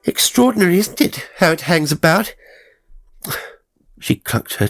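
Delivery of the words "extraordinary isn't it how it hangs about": high, loud and brisk; breezy chatter hiding her alarm; brisk, matter-of-fact. A narrator's reading. Sister pretending not to notice his collapse, brisk and high. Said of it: "talk in a brisk, matter-of-fact voice".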